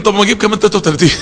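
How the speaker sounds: rattled off very fast; hurried mock counting; rushed counting. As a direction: rush it like ticking off a count. Mocking rote counting of dhikr.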